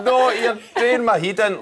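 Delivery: high, laughing Glaswegian "you're doing my head in"; correct Glaswegian. Shown as a demonstration. Glaswegian host corrects guest's phrase, high and laughing. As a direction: show him how a Glaswegian says it.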